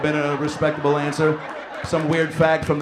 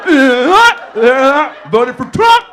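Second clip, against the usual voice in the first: high, loud stammering yell, uh uh uh then blurted; hammed-up clueless guy blurting it out; mock dumb Trump voter; 9 semitones higher, 11 dB louder, much slower than usual. Comic mocking audience member Brett, loud dopey blurt. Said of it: "was like".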